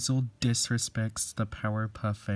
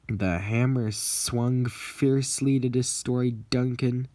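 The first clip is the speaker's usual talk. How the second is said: plain reading voice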